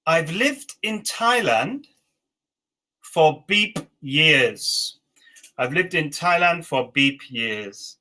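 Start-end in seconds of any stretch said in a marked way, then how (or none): 0.1-5.0 slow, words spaced out; slow dictation. Shown as a demonstration. Teacher dictating the last sentence slowly. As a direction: stretch it out for the learners. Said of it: "And the last one".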